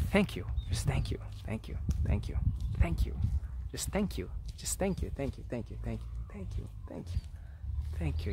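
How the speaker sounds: soft whispered thank-yous, repeated; hushed devotional whisper of thanks; whispered mantra. Shown as a demonstration. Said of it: "one of the best mantras you could say".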